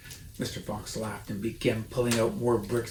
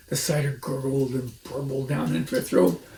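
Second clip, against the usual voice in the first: ordinary delivery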